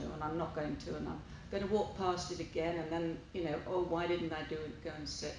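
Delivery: normal talking voice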